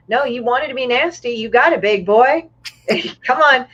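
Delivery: high, teasing come-on to a creep; mock flirty taunt. To the harasser, mocking his come-on, high teasing taunt. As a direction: bait him like a sleazy flirt.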